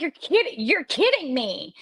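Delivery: can't believe what she's hearing; disbelieving. Retold or imitated. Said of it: "I was like".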